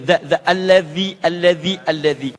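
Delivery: "alladhi" with a voiced th, tongue lightly bitten; dental dh. Shown as a demonstration. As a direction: let the tongue touch the teeth on "alladhi". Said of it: "sedikit digigit lidahnya".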